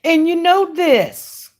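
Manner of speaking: loud, high, drawn-out catchphrase; big playful holler, stretched out; emphatic boast. Real, not acted. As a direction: crow it slowly and loudly. Laughing boast about carrying a permit.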